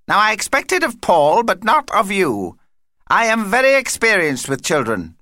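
high, pompous indignation; affronted vampire. Acted. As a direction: scold and boast in a high, self-important voice. Vampire Vlad offended, insisting.

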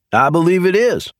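high, pointed comeback; quick retort. A narrator's reading. Leroy retorting to the sheriff, pointed quick comeback. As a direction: snap it right back at him. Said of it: "Leroy shot back".

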